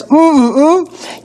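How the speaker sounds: high yummy mm-mm-mm; savoring hum. Acted. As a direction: hum with delight at the taste. Savoring the juice.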